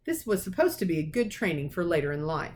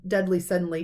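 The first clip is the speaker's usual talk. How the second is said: plain reading voice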